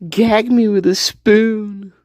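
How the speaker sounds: put-on slow, drawn-out valley girl line; a light valley girl gag. Mocking his sister in a slow valley girl voice. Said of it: "She's a valley girl".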